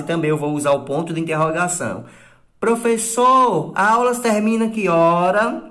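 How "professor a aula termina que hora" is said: higher, louder, drawn-out calling to the teacher; imitating a pupil. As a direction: mimic a kid calling out a question. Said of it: "Vocês também perguntam muito". (imitando aluno chamando o professor, mais alto e agudo)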